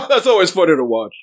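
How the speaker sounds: amused, chuckling line; laughing neighbor. Acted. Distant neighbor chuckles at the fleeing woman. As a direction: laugh through it. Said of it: "you hear far behind".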